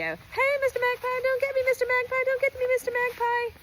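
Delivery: high, loud, squeaky chant; pleading runner. Pretend. Runner begging the magpie to leave, high squeal. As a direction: wave your arms and squeal at the bird. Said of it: "you gotta wave your hands in the air like this and go".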